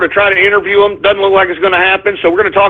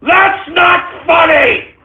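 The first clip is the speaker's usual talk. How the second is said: slow, high, yelled reproach; shouted rebuke; 7 semitones higher, much slower than usual. Real, not acted. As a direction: bellow it at the prankster. Host yelling after prank.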